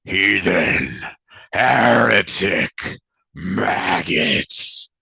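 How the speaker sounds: loud bellowing hog voice, slow on heretic; many voices yelling as one hog; hog god's yell. Acted. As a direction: roar it out like an angry hog god. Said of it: "simultaneously yell in the voice of that hog".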